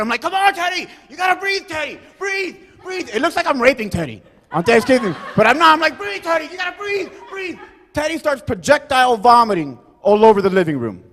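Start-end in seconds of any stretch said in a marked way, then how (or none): 0.2-3.1 high, strained mock-panicked pleading; frantic urging. Urging choking friend to breathe. 6.0-7.6 very high mock-desperate pleading; frantic urging. Urging choking friend to breathe.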